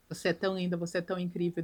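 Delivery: low, soft, intimate compliments; soft seduction. Retold or imitated. Ed leaning in close to the crying Cherry, soft and low. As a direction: murmur it close to her ear.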